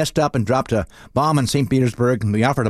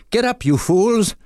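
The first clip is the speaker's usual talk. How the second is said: high, drawn-out mock yell; warning call; 8 semitones higher, slower than usual. Bystander calling to people lying on trolley tracks, mock shouted warning. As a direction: call out a hammed-up warning from afar.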